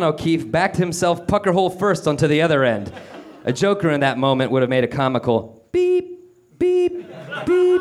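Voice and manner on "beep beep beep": high, evenly spaced reversing-alarm beeps; truck reversing beeps. Acted. A joker mocking Calvin backing up, high truck beeps. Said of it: "truck reversing noise".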